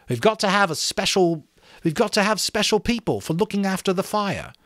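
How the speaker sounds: rally the others to keep the signal; earnest schoolboy leader. Acted. Ralph urging the boys to make rules, earnest.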